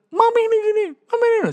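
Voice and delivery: put-on very high, loud whining child; whiny child. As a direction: complain like a sulky teenager. Child complaining about mother.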